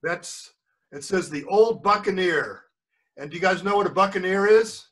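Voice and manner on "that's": ordinary delivery, nothing marked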